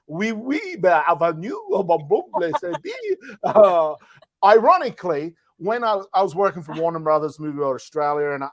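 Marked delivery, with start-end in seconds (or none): 0.1-2.9 mock French babble, jumping in pitch; French accent gibberish. Improviser showing off his training, in gibberish with a French accent. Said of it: "do it in a French accent".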